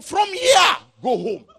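high, loud mock order; mock dismissal. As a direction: shoo them home. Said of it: "They will just come".

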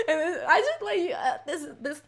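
high-pitched confession breaking into laughter; squealing through uncontrolled laughter; real helpless laughing. Real, not acted. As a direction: laugh helplessly while admitting something sad. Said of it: "I don't know why I'm laughing like this".

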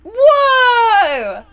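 loud, high amazed whoa; excited. Real, not acted. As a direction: gasp out in wonder. Amazed at the tree.

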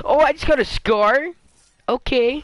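high, loud, slowed astonishment; can't believe his luck; surprised. Real, not acted. Player finds a scar and reacts, high, loud and slow. Finds rare gun in game.

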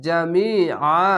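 slow "জামিআ" with long মি and আ; chanted "জামিআ", vowels drawn out; one-alif elongation. Shown as a demonstration. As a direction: stretch মি, hold the final আ and stop. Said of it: "এক আলিফ টেনে পড়বেন".

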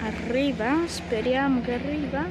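usual speaking voice

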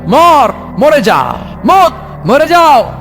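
high, slow, ringing order; stern command. Acted. Allah ordering the angel of death to die, commanding cry. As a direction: call out each word as a death sentence.

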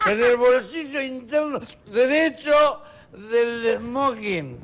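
put-on high, loud, slow drunk heckling; drunk heckler. Drunk at the front table heckling the magician, loud and slurred. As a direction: slur it out like a tipsy know-it-all from the front table. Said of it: "el borracho desde la mesa dice".

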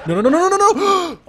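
high, loud panicked no's; panicked screams. Real, not acted. Chased by a rabid dog.

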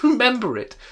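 high, loud, fervent exclamation; fervent cry. Acted. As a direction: cry out with fervour. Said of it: "cried Scrooge with fervour".

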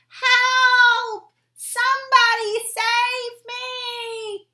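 high, loud singsong cry for help; stranded bullfrog crying out for rescue, high loud; shouting for help. Acted. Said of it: "he shouted".

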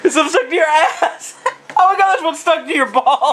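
giggling through the words; laughing at the prank. Real, not acted. Laughing at dad.

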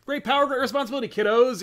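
high, fast, chipper superhero voice; cheesy superhero one-liner; hammy hero quip. Pretend. Imagined Peter quipping to rescuee.

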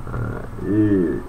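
normal talking voice

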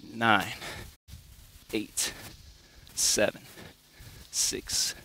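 quiet, strained counting mid push-up; counting workout reps. Real, not acted. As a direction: count reps under your breath while working. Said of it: "I'm gonna count it out for you too".

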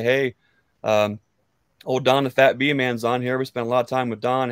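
ordinary delivery

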